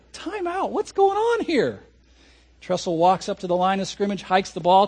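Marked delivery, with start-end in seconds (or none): 0.1-1.8 higher, stunned time-out call; a fan who can't believe his eyes; baffled fan. Retold or imitated.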